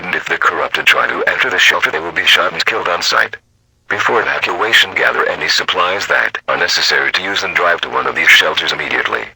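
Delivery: ordinary delivery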